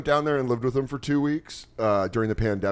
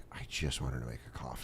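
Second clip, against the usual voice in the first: much lower, much softer groggy grumble; quiet; 10 semitones lower, 15 dB quieter than usual. Retold or imitated. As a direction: mumble it half awake. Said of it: "you're like".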